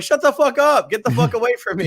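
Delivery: loud, high acted-out yell; pissed-off shouting. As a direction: drive them away with an outraged shout. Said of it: "I was so pissed off".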